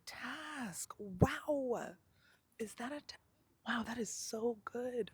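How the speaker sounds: high, loud mock wonder at the task; mock amazement. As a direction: gush over the task like a distracted kid. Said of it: "And I'm like".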